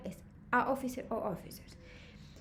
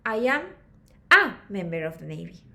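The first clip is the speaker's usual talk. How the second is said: loud A set apart after a pause; pause, then punch the A; stress on A; 6 semitones lower, 7 dB louder than usual. Shown as a demonstration. Teacher modelling a speaking answer, stress on A. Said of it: "se usa an".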